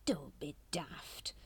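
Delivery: slow, hushed severe rebuke. A narrator's reading. Watchtower scolding Plasterer, severe and hushed. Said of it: "said Brother Watchtower severely".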